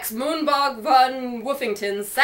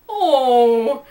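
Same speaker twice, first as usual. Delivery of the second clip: mouthful oh; 6 dB louder than usual. A narrator's reading. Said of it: "muffled sound of having a mouthful".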